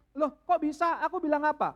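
high, loud disbelief; can't believe he's blaming her; indignant surprise. Retold or imitated. Istri bingung membela diri. (istri heran dan kesal)

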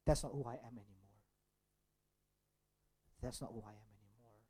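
soft inner resolve, dropping low; a lightly voiced quiet vow; quiet self-talk. Shown as a demonstration. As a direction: say it softly to yourself. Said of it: "is simply by saying".

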